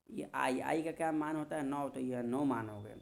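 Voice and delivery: ordinary delivery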